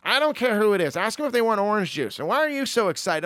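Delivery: coworker's voice. Retold or imitated. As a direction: play the unimpressed coworker, pushing. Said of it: "And she's like".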